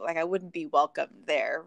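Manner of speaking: ordinary delivery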